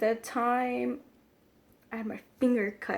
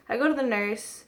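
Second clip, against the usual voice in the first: ordinary talk, nothing marked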